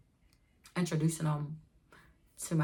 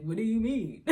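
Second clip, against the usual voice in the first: lightly voiced goofy boyfriend; 4 semitones higher than usual. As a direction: play the confused goofy guy. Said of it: "he's looking at me all goofy".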